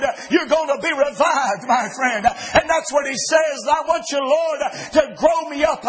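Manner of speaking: rising, ringing revival preaching; fervent pulpit exhortation; preaching with fervor. Acted. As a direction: lift it up and drive it home. Preaching rises again.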